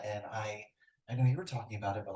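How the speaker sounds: normal talking voice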